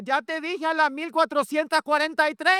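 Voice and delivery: high-pitched exasperated mock shout; hammed-up yell, stretching the final number; parent yelling. Preacher imitates a parent endlessly counting, mock yell. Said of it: "le grita así".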